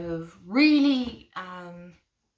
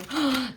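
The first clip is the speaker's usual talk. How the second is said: sharp, louder, higher gasp; delighted gasp; 6 semitones higher, 5 dB louder than usual. Real, not acted. Showing off cute tarot cards to viewers, delighted gasp. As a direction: gasp at something adorable.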